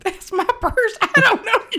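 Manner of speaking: very high, loud squeal; high screechy purse lady; meme shout. Retold or imitated. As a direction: shriek it like a viral clip. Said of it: "meme impression".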